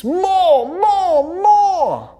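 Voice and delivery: high, loud cheer; cheering crowd. A narrator's reading. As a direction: cheer for an encore. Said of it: "cheered the audience".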